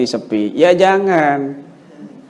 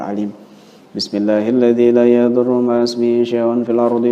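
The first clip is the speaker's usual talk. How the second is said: steady, lower recitation of the protective prayer, said three times; 4 semitones lower than usual. Shown as a demonstration. Showing a patient the prayer to say over food, steady low recitation. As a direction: recite it evenly, like a prayer you know by heart.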